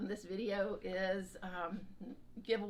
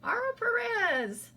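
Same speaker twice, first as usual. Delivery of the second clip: 1 excited announcement; 11 dB louder, slower than usual. Real, not acted. Announcing giveaway winner.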